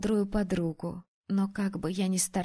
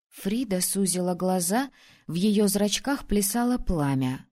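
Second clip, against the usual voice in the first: ordinary delivery, nothing marked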